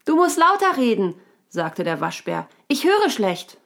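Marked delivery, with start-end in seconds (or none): 0.0-1.1 high, loud character voice; hard-of-hearing raccoon. A narrator's reading. Raccoon who didn't catch the answer asks, high and loud. 2.7-3.6 high, loud character voice; hard-of-hearing raccoon. A narrator's reading. Raccoon who didn't catch the answer asks, high and loud.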